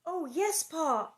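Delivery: put-on high, eager little girl. A narrator's reading. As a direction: agree keenly, pitch lifted. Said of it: "Carrie said eagerly".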